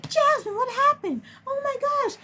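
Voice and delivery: high-pitched, distressed imitation of the coordinator; panicked coordinator. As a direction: squeal it out in mock alarm. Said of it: "the family service coordinator was like".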